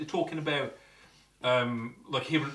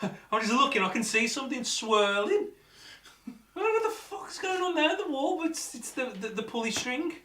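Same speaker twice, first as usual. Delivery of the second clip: high-pitched, puzzled alarm; real surprise climbing high, then working it out; startled confusion; 10 semitones higher than usual. Real, not acted. Ghost hunter spotting something swirling on wall, baffled high-pitched surprise.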